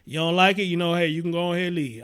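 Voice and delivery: usual speaking voice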